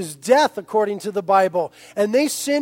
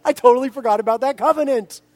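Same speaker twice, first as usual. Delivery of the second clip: mock forgetful God; 2 semitones higher than usual. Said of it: "the Lord is gonna go".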